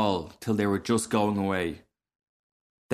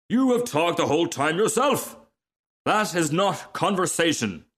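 higher-pitched, mildly cross complaint; indignant protest; 8 semitones higher than usual. Acted. Rocket scolding the talkative frog, mildly indignant. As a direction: object with offended dignity.

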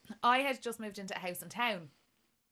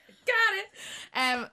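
excited; 10 semitones higher, 5 dB louder, much slower than usual. Real, not acted. Winning the cracker pull.

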